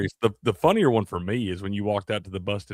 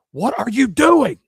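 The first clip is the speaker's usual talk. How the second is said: loud, high, stretched-out yell; mock outraged yell; 10 dB louder, slower than usual. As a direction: play a shocked outburst at top pitch. Acted outcry while spiking F-bombs.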